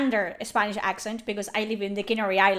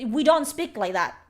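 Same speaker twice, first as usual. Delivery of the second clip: normal talking voice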